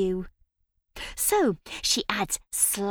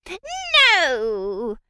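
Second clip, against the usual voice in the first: witch voice; 6 semitones higher, 5 dB louder than usual. Acted. Witch rejects the result.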